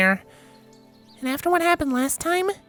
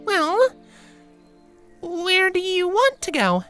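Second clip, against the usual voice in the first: slow, high, hesitant character voice; uneasy high-pitched pony, slowly asking; poorly hidden unease; slower than usual. A narrator's reading. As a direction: draw it out, trying to hide your nerves. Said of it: "hiding the unease in those words".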